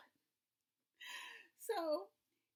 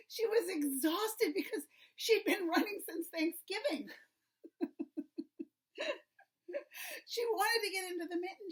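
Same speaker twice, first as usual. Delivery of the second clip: laughing; 8 semitones higher, 6 dB louder than usual. A narrator's reading. Frantic turkey described, laughing.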